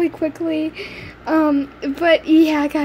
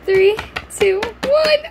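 louder, rising countdown; excited countdown; 4 semitones higher, 5 dB louder, slower than usual. Real, not acted. Girl counting down to reveal her mascot, building excitement.

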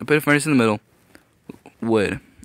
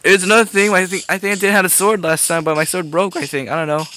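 usual speaking voice

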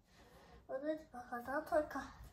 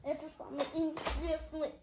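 usual speaking voice

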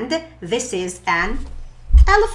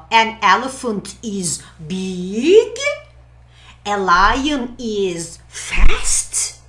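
deliberate slow review; 4 dB louder, slower than usual. Shown as a demonstration. Teacher reviews animal traits.

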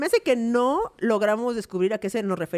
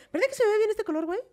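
fishing for compliments; 8 semitones higher, 3 dB quieter, faster than usual. Retold or imitated. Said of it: "si yo te digo".

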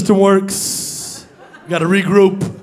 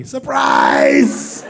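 drawn-out, rough shout; surprise reveal. Acted. Springs a reveal on audience.

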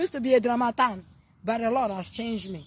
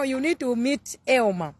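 ordinary delivery

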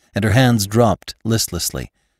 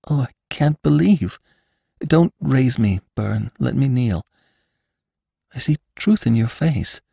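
put-on higher, imploring young woman's voice; pleading Bess; 5 semitones higher than usual. Bess begs Lassiter to tell her everything, higher and imploring. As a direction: beg to hear it all on her knees.

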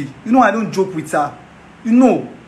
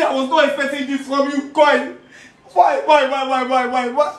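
high, tearful wail; betrayed friend lamenting his lost love, sobbing; crying lament; 9 semitones higher than usual. Acted. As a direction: sob out the heartbreak. Heartbroken lament through tears.

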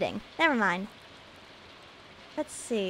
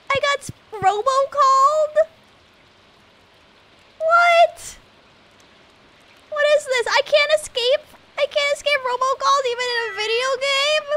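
high, loud disbelief; can't believe this is happening; incredulous outrage; 11 semitones higher, 5 dB louder than usual. Real, not acted. Outraged at robocall.

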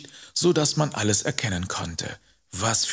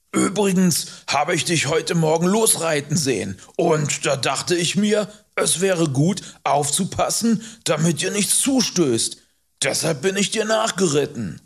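higher, emphatic character voice; Hubert, self-assured rider; 6 semitones higher, 4 dB louder than usual. Acted. Rider explains why he followed the boy, emphatically. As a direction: explain yourself with firm, raised delivery.

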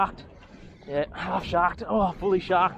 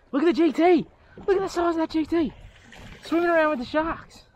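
excited; 11 semitones higher, faster than usual. Real, not acted. Still thrilled at the fish.

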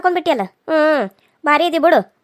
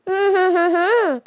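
sobs; 3 semitones higher, slower than usual. Acted. Sobbing after crying complaint.

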